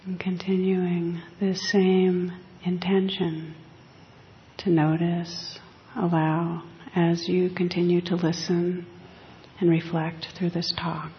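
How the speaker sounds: slow, soft meditation voice; guided meditation. Acted. Teacher guiding the listeners into quiet reflection, soft and slow. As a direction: ease listeners gently into stillness.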